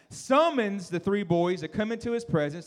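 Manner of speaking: usual speaking voice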